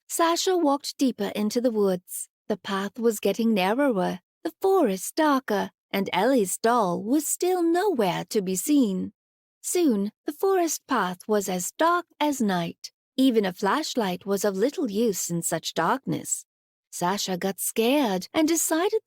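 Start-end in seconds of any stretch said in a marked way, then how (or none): none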